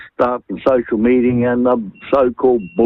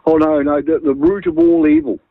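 mock alarm; 9 semitones higher than usual. Mocking old panic about television.

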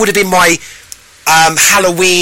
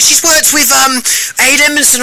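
high posh voice, held up throughout; impressed posh man; 9 semitones higher than usual. Retold or imitated. Posh man recognising the guest, high impressed posh voice. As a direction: imitate an excited posh man, pitched very high. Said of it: "he is actually posh".

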